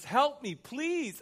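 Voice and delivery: very high-pitched begging; pleading. Acted. Woman pleading with Jesus for help, high-pitched begging. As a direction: push the plea right up high, desperately. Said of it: "Here is this woman pleading".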